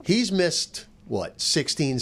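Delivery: usual speaking voice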